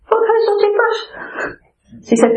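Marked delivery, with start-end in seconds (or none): 0.1-1.0 breezy, innocent question in a high voice; mock casual. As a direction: act as if nothing is wrong. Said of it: "said to her casually".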